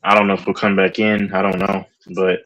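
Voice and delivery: ordinary talk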